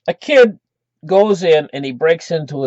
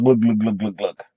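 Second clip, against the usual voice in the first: low, quieter glug-glug of a drink going down; imitated glugging; 6 semitones lower, 3 dB quieter than usual. Storyteller mimics a kid swigging vodka, imitated glugs. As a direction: mimic a bottle being gulped.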